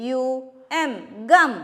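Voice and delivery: usual speaking voice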